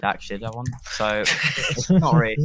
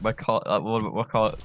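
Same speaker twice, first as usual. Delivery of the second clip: usual speaking voice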